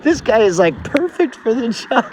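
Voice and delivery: impressed employers. Retold or imitated. Employers quoted approving him.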